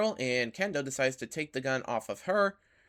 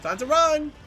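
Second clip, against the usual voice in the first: high loud yell to flee; panicked shout; 13 semitones higher, 9 dB louder than usual. Real, not acted. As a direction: shout it while running away. Fleeing monster in game.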